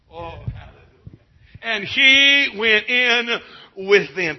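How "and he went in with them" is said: high, loud, slow, triumphant proclamation; ringing proclamation. Shown as a demonstration.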